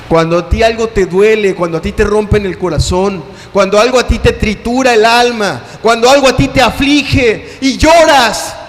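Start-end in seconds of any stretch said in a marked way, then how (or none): 3.5-8.6 rising to a loud impassioned cry; building to a shout. Acted. Preacher at the sermon's climax, building to a shout. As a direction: climb and shout the last line.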